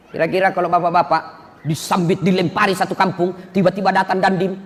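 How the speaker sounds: ordinary talk, nothing marked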